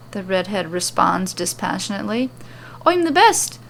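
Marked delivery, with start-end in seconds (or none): 2.9-3.6 boastful brag. Acted. Becky boasting her titles.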